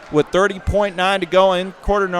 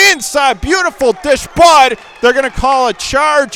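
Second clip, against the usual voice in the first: excited call; 9 semitones higher, 6 dB louder than usual. Real, not acted. Live call of a charging foul.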